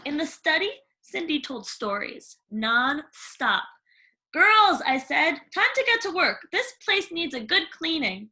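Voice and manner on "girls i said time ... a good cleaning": put-on high, loud call to the girls; bossy stepmother. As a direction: order them to clean. Stepmother commands daughters.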